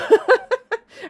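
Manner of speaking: loud squeaky laugh; high delighted laugh. Real, not acted. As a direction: burst out giggling.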